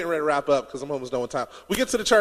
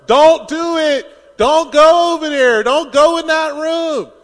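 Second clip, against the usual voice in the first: much louder, higher and slow warning; yelling at the screen; 9 semitones higher, 9 dB louder, slower than usual. Retold or imitated. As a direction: shout at the girl in the movie not to go. Audience yelling at horror victim.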